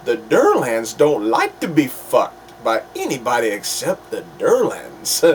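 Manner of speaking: bad tough-guy impression, pitch swinging wildly; horrible Samuel L. Jackson. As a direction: badly imitate an emphatic movie tough guy, dropping low at the end. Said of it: "that was a horrible Samuel L. Jackson impression".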